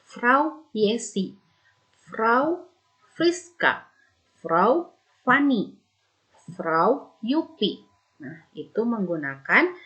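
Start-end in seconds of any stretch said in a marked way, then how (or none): 0.0-7.8 slow, drawn-out "Frau" with each name; teacher giving slow, clear examples of Frau with names. Shown as a demonstration. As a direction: spell each example out for the class. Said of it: "harus diikuti dengan nama".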